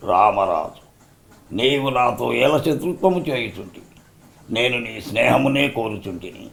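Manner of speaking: soft, measured officer coaxing the rebel; stately old film dialogue, held back; quiet, formal persuasion; Jaggayya as Rutherford. Acted. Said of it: "వాళ్ళిద్దరు ఏ విధంగా dialogue చెప్పారు".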